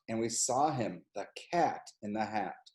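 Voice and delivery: hushed storyteller reveal. A narrator's reading. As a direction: drop the voice for the reveal. Dramatic reveal of the cat.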